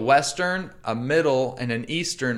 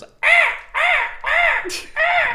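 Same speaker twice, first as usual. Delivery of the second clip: high honking bird-call cry; imitated goose call; 4 dB louder, much slower than usual. Retelling a hunting show, he honks like geese. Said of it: "all I hear, heard was".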